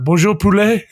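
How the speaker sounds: hammed-up French accent; French greeting. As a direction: greet him in mock French like a flustered player. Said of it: "Starts speaking in French".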